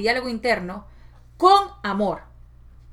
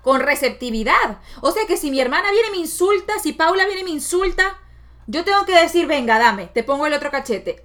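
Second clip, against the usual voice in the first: higher, louder disbelieving reply; incredulous audience; 6 semitones higher, 5 dB louder than usual. Retold or imitated. Viewers doubting her advice, mildly incredulous. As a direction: sound shocked and doubtful. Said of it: "ustedes dicen".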